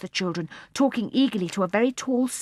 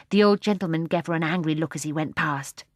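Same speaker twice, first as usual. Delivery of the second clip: normal talking voice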